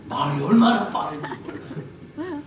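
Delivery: usual speaking voice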